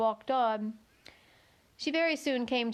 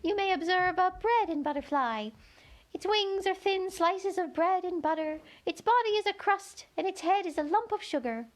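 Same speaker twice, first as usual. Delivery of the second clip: high, thin little insect voice; a tiny gnat piping up to Alice; 8 semitones higher than usual. A narrator's reading. Gnat telling Alice where to look, high and thin. Gnat describes bread-and-butterfly.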